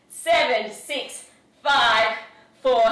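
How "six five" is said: ordinary talk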